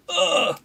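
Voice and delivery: high strained groan; pained grunt. Acted. Game master voices a wounded guard getting up, pained grunt. Guard struggles up from one knee.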